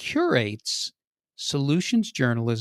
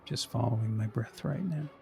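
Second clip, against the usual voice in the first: much softer and lower, meditative murmur; hushed fake zen; 3 semitones lower, 6 dB quieter than usual. Caller deep in debt, murmuring in mock meditation. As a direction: keep up the put-on serenity. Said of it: "Notice how very calm I am".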